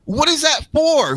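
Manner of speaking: very high-pitched incredulous demand; exasperated rant. Retold or imitated. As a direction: demand an explanation in exaggerated disbelief. Ranting about a pointless shirt.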